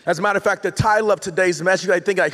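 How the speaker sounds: normal talking voice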